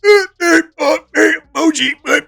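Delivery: put-on high babbling kid voice; mocking clueless kids. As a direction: imitate kids grunting nonsense. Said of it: "instead of going".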